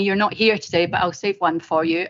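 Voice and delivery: usual speaking voice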